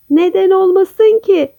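high, insistent comeback; stubborn. A narrator's reading. Kaju stubbornly answers the doubting birds, high and insistent. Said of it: "Kaju inat etmiş".

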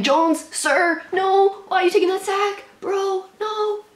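high-pitched desperate protest; dismayed pleading. Real, not acted. Fan watching the quarterback take a sack, dismayed. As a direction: plead with the player, voice shooting up.

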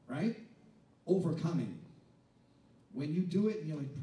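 ordinary talk, nothing marked

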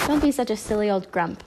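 usual speaking voice

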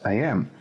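"I am" as two distinct words; two separate words. Shown as a demonstration. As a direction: pronounce "I am" uncontracted, each word on its own. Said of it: "como si fueran separados".

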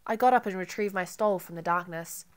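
ordinary delivery, nothing marked

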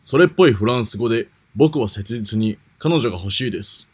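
usual speaking voice

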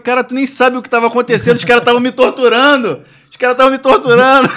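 high, loud mock yell; shouted retold indignation; hammed-up outrage. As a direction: protest at full volume as the wronged son. Indignant quarrel with mother, retold.

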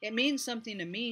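declaring firmly. A narrator's reading. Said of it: "Stormy declared".

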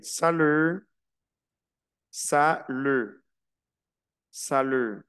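"salut" said slowly, silent final T, "salu"; French pronunciation drill. Shown as a demonstration. Teacher drilling French class: "salut" with silent T. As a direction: model "salut" for students to repeat, T silent.

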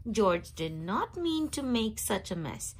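usual speaking voice

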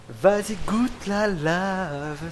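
high, slow, teasing taunt; mocking taunt. Real, not acted. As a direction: drawl it out mockingly. Taunts character in lava.

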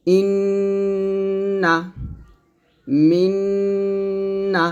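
ইন্না, মিন্না, সুম্মা each drawn out with a long hummed nasal on the doubled consonant; nasal ghunna. Shown as a demonstration. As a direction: hum the nn and mm through the nose, slowly. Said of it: "গুণাটা কিন্তু নাকের মধ্যে বাজতেছে".

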